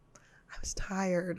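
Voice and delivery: slow whispered excuse; sheepish whisper. Retold or imitated. Calling each family member to apologise, slow and whispered. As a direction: whisper it like a guilty kid.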